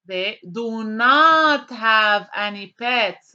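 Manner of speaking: They do NOT have any pets, slow with NOT leaned on; slow stressed not. Shown as a demonstration. Teacher giving students the corrected sentence, slow with NOT stressed. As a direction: give the correct form slowly, leaning on NOT. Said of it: "That's right, do not".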